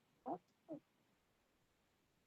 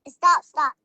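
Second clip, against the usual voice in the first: urgent stop; 3 semitones lower, 11 dB louder than usual. Real, not acted. Child urgently halts mommy.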